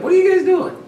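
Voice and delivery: high, loud what are you guys doing; disbelieving shout at the fighters; incredulous shout. Retold or imitated. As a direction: yell it in disbelief at the brawlers. Mock exasperated question.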